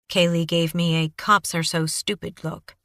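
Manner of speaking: normal talking voice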